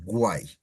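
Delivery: guay said gwai, each vowel clear; all vowels. Shown as a demonstration. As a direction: sound every vowel in guay. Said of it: "you say all the vowels".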